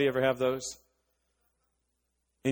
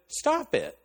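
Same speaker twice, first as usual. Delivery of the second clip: high-pitched retold protest; exasperated interruption; 11 semitones higher than usual. Telling sister to stop complaining, high exasperated protest. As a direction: cut off the whining, pitched way up.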